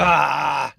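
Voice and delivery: loser's drawn-out "ah"; frustrated groan. Real, not acted. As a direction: groan at a wrong guess. Groans at losing the question.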